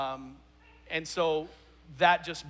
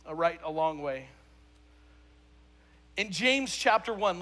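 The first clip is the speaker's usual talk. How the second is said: ordinary talk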